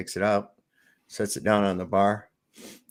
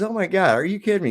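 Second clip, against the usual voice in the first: much higher, louder disbelief; amazed bartender; 9 semitones higher, 4 dB louder, faster than usual. Acted. Bartender hears about the genie, thrilled. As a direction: squeal with amazement. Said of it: "He says".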